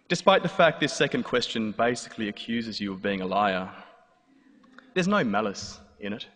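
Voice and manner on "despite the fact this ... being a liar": ordinary delivery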